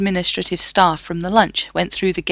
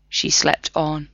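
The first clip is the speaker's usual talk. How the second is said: plain reading voice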